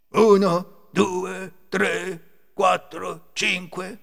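loud, high, deliberate count; thief counting. A narrator's reading. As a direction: count out each number loudly. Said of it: "E ricomincia".

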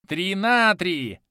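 put-on high, loud, slow childish glee; gleeful mad god. A narrator's reading. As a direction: clap and crow like a crazed child. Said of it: "вновь захлопал в ладошки Кармадонт".

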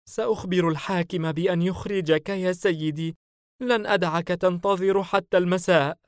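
high, loud female character in panic; panicked. A narrator's reading. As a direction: promise help in panic. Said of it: "ابتعدت سعلوة ملتصقة بالجدار هلعا". (هلع بصوت عال)

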